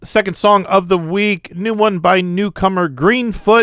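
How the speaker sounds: radio announcer. Acted. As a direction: announce the next track like a DJ. Host announces next song.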